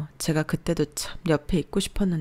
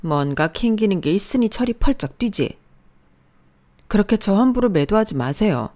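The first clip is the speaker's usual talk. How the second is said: plain reading voice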